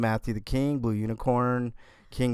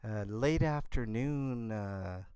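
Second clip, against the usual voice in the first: odd put-on voice; 9 dB quieter than usual. Real, not acted. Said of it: "I don't know why I'm talking like this".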